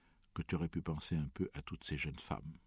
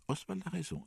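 high, light woman's voice; female voice; 13 semitones higher than usual. Acted. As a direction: voice the woman higher. (voix de femme aiguë)

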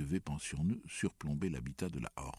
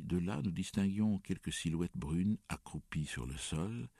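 ordinary delivery

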